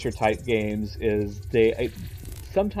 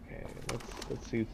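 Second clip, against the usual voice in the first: whispering; 14 dB quieter than usual. Real, not acted. Sneaking around the apartments.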